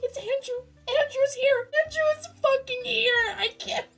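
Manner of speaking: high, loud disbelief; can't contain the excitement; fangirl squeal. Retold or imitated. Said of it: "Sorry for my incessant screaming".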